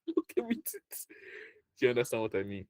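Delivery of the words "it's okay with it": high, softer words squeezed through laughter; laughing. Real, not acted. Joking about giving up the job hunt, laughing. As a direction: finish the joke while cracking up.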